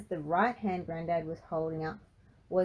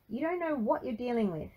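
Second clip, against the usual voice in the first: higher-pitched heated warning; shot back; 6 semitones higher than usual. A narrator's reading. Granddad warns Frankie off, retorting. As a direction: keep the retort going, pitch raised. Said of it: "Grandad shot back".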